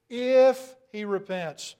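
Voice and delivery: IF hit loud and slow; slow down and punch the word If; stress on IF. Shown as a demonstration. Stressing the condition.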